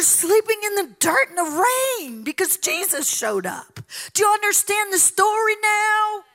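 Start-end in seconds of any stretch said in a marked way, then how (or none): 4.1-6.2 raised, higher preaching voice; louder, pitched-up challenge; preaching shout. Real, not acted. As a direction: demand it of the crowd.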